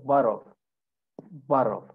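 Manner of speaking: "bottle" with a quick American tap for the Ts; American tapped T. Shown as a demonstration. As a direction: say "bottle" like boddle. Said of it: "it becomes like a D sound".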